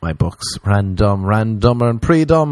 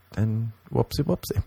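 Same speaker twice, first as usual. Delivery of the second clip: ordinary talk, nothing marked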